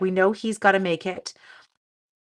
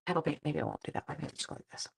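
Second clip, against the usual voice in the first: ordinary delivery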